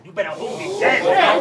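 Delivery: high, loud punchline bark; shouted punchline. Acted. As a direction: yell the punchline at him.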